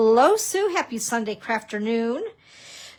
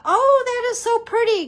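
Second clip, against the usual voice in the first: a much higher, louder burst of delight; delighted gasp; 12 semitones higher, 6 dB louder than usual. Retold or imitated. Crafter recalling spotting a pretty card, delighted squeal. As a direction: gush over something pretty. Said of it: "and I thought".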